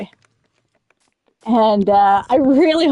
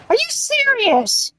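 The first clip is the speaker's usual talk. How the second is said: high disbelieving outrage; can't believe it; incredulous; 10 semitones higher than usual. Real, not acted. Outraged at the game.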